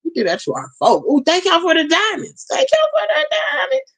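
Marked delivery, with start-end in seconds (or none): 1.1-3.9 much higher, gushing thanks; excited thanks. Real, not acted. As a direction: squeal thanks to the viewers for the diamonds. Thanking viewers for gifts.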